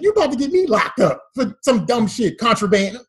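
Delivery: high comic protest, peaking loud; outraged refusal. Retold or imitated. Thirteen-year-old refusing his uncle over contraband, outraged refusal. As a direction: build to an indignant yell.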